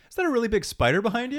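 high, quick mock-startled question; fake alarm. Sam distracting the girlfriend to escape, high fake alarm. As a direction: blurt it high as an obvious distraction.

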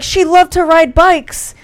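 high, drawn-out announcement; mock medium's call. A medium throwing generic clues to the room, calling out. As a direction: slowly call out the vague clue.

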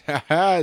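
high squawking screech like a pterodactyl; pterodactyl shriek. Real, not acted. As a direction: let out a loud bird-like shriek. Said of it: "There it was, pterodactyl sound".

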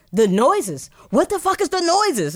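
high, exasperated outburst over noise; mock irritation. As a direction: imitate someone quickly annoyed, pitch jumping up. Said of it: "I mean, it's quick, too. You be like".

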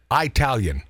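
"Italian" said as "Eye-talian", with a long EYE sound first. Shown as a demonstration. Host points out his own slip, saying "Italian" as Eye-talian. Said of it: "Did you notice I said Italian".

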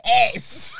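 high, loud burst of laughter; laughing yes. Real, not acted. Admitting to his friend she grabbed him, laughing hard. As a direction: laugh it out in disbelief.

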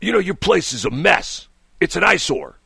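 imitated grumbling caller; complaining caller. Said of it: "He says".

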